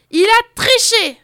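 loud, high accusing shout; indignant cry. Acted. Crowd of wolves shouting at the cheater. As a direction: call out the cheat.

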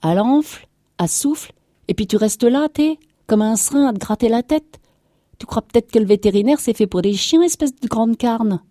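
high, loud scolding tirade; angry shouting. A narrator's reading. Farmer's wife berating her idle husband, furious shouting. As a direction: rail at him, shrieking up high. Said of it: "elle cria".

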